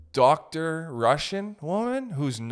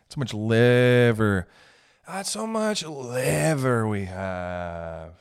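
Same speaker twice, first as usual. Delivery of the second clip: stretched, very slow words; slow-motion comic drawl; slow drawn out; much slower than usual. Pretend. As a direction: drag each word out for comic effect. Drawn-out comic liver bit.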